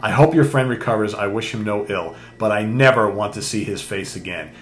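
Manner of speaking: ordinary talk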